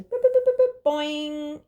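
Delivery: high, loud boops into a long springy boing; bouncing sound effects. Shown as a demonstration. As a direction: voice light ricocheting with cartoon bounces. Imitates light bouncing off surfaces.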